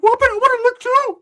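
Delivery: very high, loud squeal; eager mock whine. Ricky wanting a look too, high loud whine. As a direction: squeal it like you're left out.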